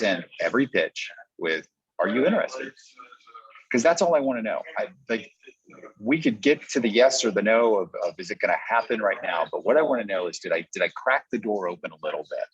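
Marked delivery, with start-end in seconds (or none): none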